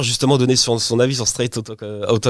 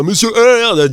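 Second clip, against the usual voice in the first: raised-pitch mock big voice; big rapper voice; 7 semitones higher, 3 dB louder, much slower than usual. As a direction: lightly imitate a rapper's big voice. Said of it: "avec sa grosse voix".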